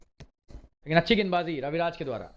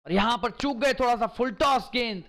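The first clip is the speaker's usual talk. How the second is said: higher, rising excitement over a six; excited cricket commentary; 7 semitones higher, 3 dB louder than usual. Real, not acted. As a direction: call the six as it flies. Commentator calls a big six.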